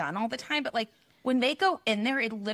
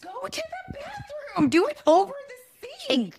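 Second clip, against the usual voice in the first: very high, squealed plea; high-pitched exasperation at a gross habit; exasperated outburst; 3 dB louder than usual. Real, not acted. Exasperated plea about nail clipping.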